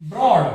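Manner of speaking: ब्रॉड said loudly with R sounded after B; R pronounced. Shown as a demonstration. Teacher gives an example, R pronounced in ब्रॉड. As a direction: say ब्रॉड with a clear R. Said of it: "वहां पे आर जरूर बोलना है".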